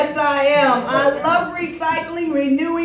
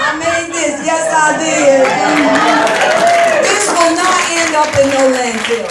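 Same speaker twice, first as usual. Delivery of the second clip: proud boast; 3 semitones higher, 7 dB louder, slower than usual. Acted. Proud showing off homemade vest.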